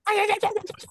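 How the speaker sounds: high squealing talk-scream, hammed up; guttural scream imitation. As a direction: mimic the wife's wild shriek. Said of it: "she just went".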